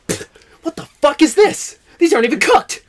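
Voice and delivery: high, loud mock outrage; indignant rat. Rat rejecting cowboy's uncooked beans, indignant outburst. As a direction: spit it out, hammed-up disgust.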